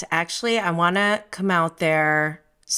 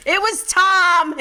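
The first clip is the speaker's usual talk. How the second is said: high, louder, slow gleeful jab; gleeful accusation; 9 semitones higher, 4 dB louder, slower than usual. Real, not acted. Blaming the patio poop on Tom, gleefully. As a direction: savor the punchline.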